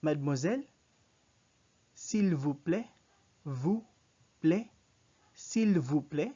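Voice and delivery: loud, careful "s'il vous plaît", long OO in "vous"; French "s'il vous plaît" in clear pieces, each rising; French pronunciation. Shown as a demonstration. Teacher models "s'il vous plaît" for learners, loud and deliberate. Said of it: "So you say".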